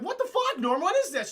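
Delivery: shrill high yelp; outraged Smythe. Retold or imitated. Smythe shrieking at Norm about the raid, high. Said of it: "Smythe's like".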